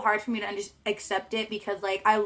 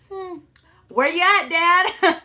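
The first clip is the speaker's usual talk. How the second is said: loud high call across the field; 9 semitones higher, 9 dB louder, much slower than usual. Retold or imitated. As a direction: call out for dad from the floor. Calling out for dad on floor.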